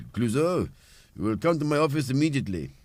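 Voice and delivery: high, loud, fast irate boss summons; film chief. Acted. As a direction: play the inspector's exasperated police chief. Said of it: "what's the chief in the film?".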